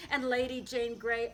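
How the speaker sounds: usual speaking voice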